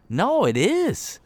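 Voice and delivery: slow emphatic correction. Real, not acted. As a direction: set them straight, slowly and high. Firm rebuttal to dismissive couples.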